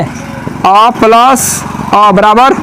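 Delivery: "अ plus अ बराबर" drawn out very slowly, with pauses between the parts; slow prompting. Shown as a demonstration. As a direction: stretch "अ plus अ बराबर" slowly, leaving the answer open. Teacher slowly prompts class for answer. ("अ plus अ बराबर" धीरे-धीरे, रुक-रुककर)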